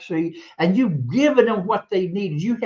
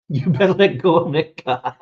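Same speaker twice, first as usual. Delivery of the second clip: louder, laughing through the warning; laughing quote; 4 dB louder than usual. Real, not acted. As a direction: laugh while repeating mom's warning. Quoting mother's warning, laughing.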